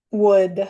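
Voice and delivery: "Would" said alone, exactly like wood; silent L in would. Shown as a demonstration. Teacher repeats the word for learners, "Would" without L. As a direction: model "Would" with the L left silent. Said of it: "no se pronuncia la L".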